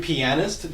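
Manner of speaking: "pianist" with a clear A in the middle; pronounced with the A. Shown as a demonstration. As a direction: say "pianist" as pi-AN-ist, keeping the A. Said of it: "Don't forget the A".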